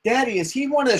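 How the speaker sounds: put-on a curious kid's question; small boy. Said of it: "little boy's going".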